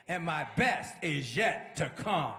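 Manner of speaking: slow, loud, word-by-word punchline; weighty, full-voiced proclamation; emphatic declaration. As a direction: hammer each word slowly. Older minister's punchline.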